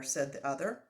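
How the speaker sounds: usual speaking voice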